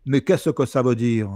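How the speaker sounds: exaggerated Toulouse accent, mocking. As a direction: parody a southern Toulouse accent to belittle her. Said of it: "d'imiter l'accent de la journaliste, qui a un accent un peu toulousain".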